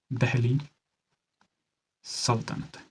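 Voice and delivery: quiet, spaced out for note-taking; slow dictation. Shown as a demonstration. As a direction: softly, split by a long pause. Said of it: "धीरे से बोल रहा हूँ".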